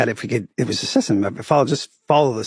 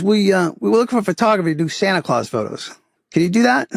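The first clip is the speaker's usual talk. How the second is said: put-on higher Boston accent asking a favor; Boston city hall guy asking; 7 semitones higher than usual. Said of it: "I'll play the Boston accent as much as I can".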